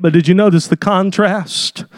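ordinary delivery

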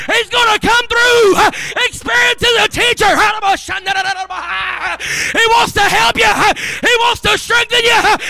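high, loud revival preaching. Real, not acted. Preacher driving home the story to the congregation, full-force shout. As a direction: build to a full-throated shout, ending on a long held cry.

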